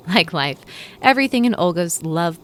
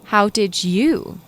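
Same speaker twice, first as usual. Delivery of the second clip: slow, louder, higher snapped-back question; sharp retort; 5 semitones higher, 6 dB louder, much slower than usual. Acted. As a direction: fire the question right back at her. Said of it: "she shot back".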